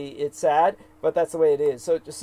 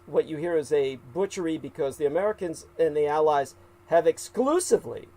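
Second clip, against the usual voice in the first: usual speaking voice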